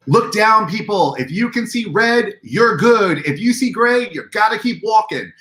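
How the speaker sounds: crowd control call. Retold or imitated. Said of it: "the infamous phrase".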